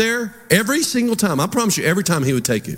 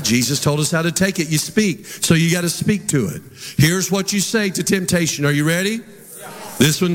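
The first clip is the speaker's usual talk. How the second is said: ordinary delivery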